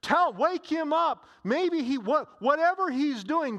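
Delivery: high, loud mocking urging; taunting call. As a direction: egg them on to wake their god. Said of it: "So he's taunting them".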